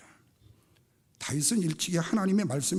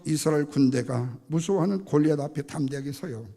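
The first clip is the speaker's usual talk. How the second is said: ordinary talk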